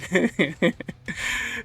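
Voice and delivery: a real, rising chuckle at the footage; a short genuine laugh; amused laugh. Real, not acted. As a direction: laugh it off, high and light. Laughing at Charles on camera.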